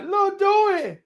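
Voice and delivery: high sing-song woman's voice calling a name. Retold or imitated. Babe calls Lil Dewey over, high sing-song voice. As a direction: lilt it up high like a flirty woman. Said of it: "Babe like".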